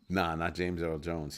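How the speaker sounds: ordinary delivery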